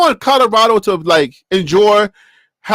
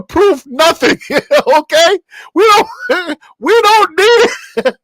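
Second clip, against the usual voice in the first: high-pitched, laughing through the words; can't keep from laughing; 8 semitones higher, slower than usual. Real, not acted. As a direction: try to say it but crack up. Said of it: "I can't say this without laughing".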